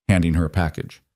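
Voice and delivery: ordinary delivery, nothing marked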